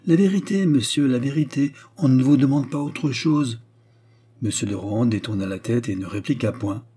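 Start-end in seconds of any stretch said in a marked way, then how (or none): 0.1-3.6 indignant insistence. A narrator's reading. Queen insisting on the truth.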